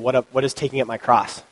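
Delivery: ordinary talk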